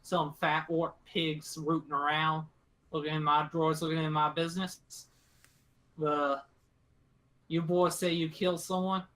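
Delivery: lightly voiced rural captor, disgruntled; grumbling country woman. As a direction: grumble in country talk about unwanted visitors. GM voices gun-toting woman.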